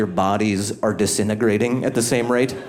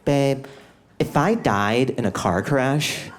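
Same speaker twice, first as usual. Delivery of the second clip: put-on girlfriend voice; 2 semitones higher, much slower than usual. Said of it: "she goes".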